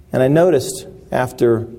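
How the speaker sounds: usual speaking voice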